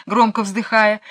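ordinary delivery

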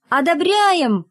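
high-pitched collective cheer; class cheering. A narrator's reading. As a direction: exclaim it like a whole class voting yes. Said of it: "вскричал класс".

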